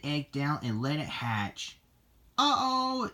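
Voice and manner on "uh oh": high-pitched alarm; a high, dismayed little exclamation; exclaiming. A narrator's reading. Lassie watches the egg hatch, high startled exclamation. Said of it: "she exclaimed".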